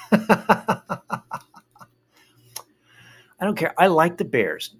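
put-on high, bratty teenage girl voice; teen daughter. Daughter teasing her dad at the game, high and bratty. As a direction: whine it like a defiant teen.

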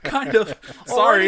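laughing through the words; giggling apology. Real, not acted. Laughing after friend's joke.

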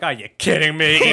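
put-on exasperated Brooklyn accent, half laughing. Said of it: "the liver as, like, some Brooklyn accent guy".

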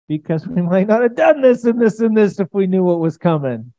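laughing. Real, not acted. Preacher laughing to the church about God's plans, loud. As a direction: laugh it out as you speak. Laughs through line loudly.

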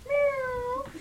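playful high-pitched meow. Acted. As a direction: meow like a cat. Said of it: "he heard Filch meow".

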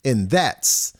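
upbeat news report delivery; news segment read. Acted.

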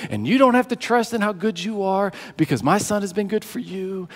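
soft-spoken, loving assurance; tender reassurance. Acted. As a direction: reassure a beloved child quietly. God speaking tenderly to a believer.

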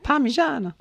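high, hammed-up Italian accent; mock Italian. Showing a friend how Italy says it, mock Italian. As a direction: sing out Parmigiana like a cartoon Italian. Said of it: "that's what they say in Italy".

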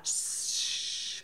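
soft, voiceless shushing noise; imitated horrible noise. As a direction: imitate a gush with a hushed "shh". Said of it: "this horrible noise of".

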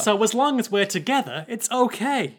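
put-on high, earnest boy; young unbroken voice; Jacuzzi. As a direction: reassure in a child's high voice. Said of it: "One whose voice is yet to break".

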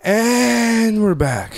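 long drawn-out, high opening word; show-host announcement. Pretend. Host reopening the podcast after a break, drawn-out announcement. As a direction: stretch the first word like a returning host.

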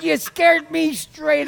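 ordinary talk, nothing marked

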